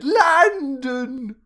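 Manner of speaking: high, loud fake British blurt; mock British accent. Student answering which part, high exaggerated British. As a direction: wail it in hammed-up British. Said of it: "I said".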